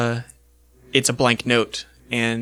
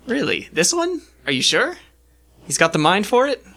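much higher, doubting elf voice; an elf who can't believe this is the one; incredulous elf; 8 semitones higher than usual. Acted. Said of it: "The, the elf goes".

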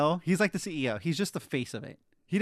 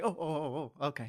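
low, soft, deferential murmur; mock subservience; 3 semitones lower, 8 dB quieter than usual. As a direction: worker acting meek as the owner walks in, mock subservience. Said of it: "when the owner walks in the store".

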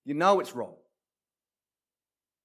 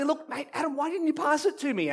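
much higher-pitched questioning; complaining friend; 11 semitones higher than usual. Acted. Friend asks Adam about the lost game, high-pitched complaint. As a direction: ask it high, as a let-down teammate. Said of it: "my friend asked me".

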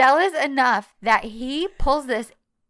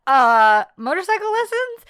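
incredulous question; 10 semitones higher, 7 dB louder than usual. Retold or imitated. Baffled quote in retold scene.

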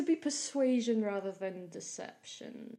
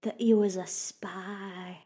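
slow, louder gossipy reveal; relishing the drama; 6 dB louder, slower than usual. Acted. Gossip revealing the spy rumour to visitors, savouring the drama. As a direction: draw out the scandal with relish. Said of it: "she is living for this drama".

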